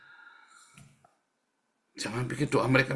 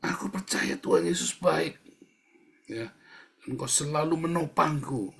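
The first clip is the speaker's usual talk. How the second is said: raised-pitch, firm statement of trust; declaration of faith; 5 semitones higher than usual. Shown as a demonstration. Preacher shows believers how to state trust, declaring it. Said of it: "Itu pernyataan".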